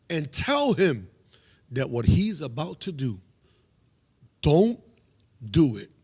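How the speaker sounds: slow, forceful, with long pauses; retold divine command. Man relaying God's warning to a pastor, slow loud command. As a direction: hit DON'T loud, imitating a solemn command.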